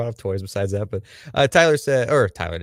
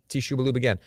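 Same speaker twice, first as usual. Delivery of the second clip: ordinary delivery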